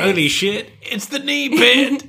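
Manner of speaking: high, slow "knee pit" reveal; mock excited. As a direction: announce it like a discovery. Echoing her astonishment.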